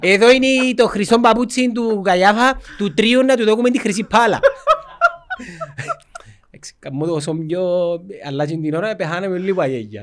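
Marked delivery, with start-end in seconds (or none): 0.0-4.4 mock tour guide. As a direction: present each exhibit like a proud guide. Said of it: "έκαμε μας το ξεναγό".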